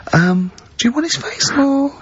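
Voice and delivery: teasing choice in a high mock voice; mock doctor offering a choice. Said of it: "it's Doctor Hanrahan".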